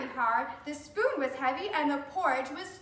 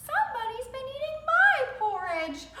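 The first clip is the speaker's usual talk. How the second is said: mother bear voice; 10 semitones higher than usual. Acted.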